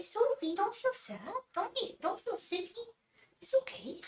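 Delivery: put-on very high baby-talk comforting; voicing the comforting cat. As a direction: speak for a cat soothing its owner.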